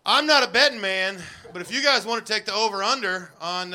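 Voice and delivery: normal talking voice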